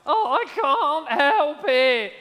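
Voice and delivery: high, slow, strained wail of a doomed bug; hammed-up helpless insect; bug's helpless cry. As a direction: whine it like a moth pulled to the light. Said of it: "the immortal words as the bug flies towards the light".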